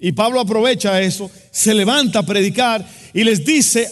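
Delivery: fervent storytelling sermon; preaching at full force. Acted. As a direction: paint the scene for the congregation.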